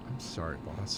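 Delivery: low, soft sheepish apology; meek apology. Acted. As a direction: mumble it sheepishly.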